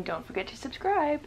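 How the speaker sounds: high voice speaking for the dog. Pretend. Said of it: "Tika's saying".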